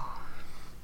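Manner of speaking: sharp breathy gasp; shocked gasp. Acted. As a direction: gasp at a sudden betrayal. Player reacts to being shackled.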